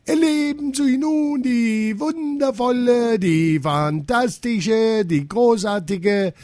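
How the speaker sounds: high, drawn-out grand act announcement; circus director calling out. A narrator's reading. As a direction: build up the star with pompous stretched words. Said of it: "zu und rief".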